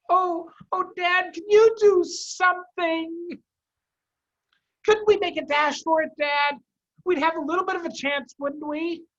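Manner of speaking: very high, loud little fox voice; small fox pleading. Acted. Cubs plead with their father, high and urgent. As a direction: beg Dad like a desperate cub.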